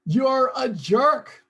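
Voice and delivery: a drawn-out, shouted insult, high and loud; mock insult. Reading a chat insult aloud, shouted slowly. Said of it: "What is it you say to yourself".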